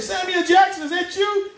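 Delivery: high, eager fan calling out; high excited fan. Acted. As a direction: squeal it like a starstruck fan. Stand-up acts fan meeting Jackson.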